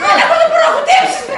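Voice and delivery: high, loud wailing protest; distraught. Acted. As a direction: protest in helpless grief. (απελπισμένα, ψηλά και δυνατά)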